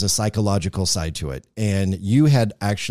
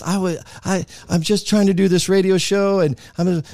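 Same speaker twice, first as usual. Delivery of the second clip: ordinary delivery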